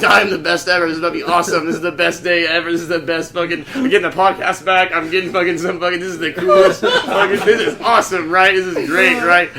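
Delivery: loud, excited run of hype; elated. Retold or imitated. Man hyping himself up in a bathroom, elated. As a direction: gush it out, building yourself up. Acting out his elated inner monologue.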